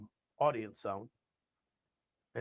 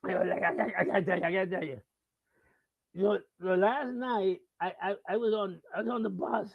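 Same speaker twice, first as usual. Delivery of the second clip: lightly voiced stammering drunk; wasted rocker; 5 semitones higher, 5 dB louder than usual. Wasted guy starting his bus story, mildly hammed-up drunk. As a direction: stumble into the story, pitched up. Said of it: "and he's wasted".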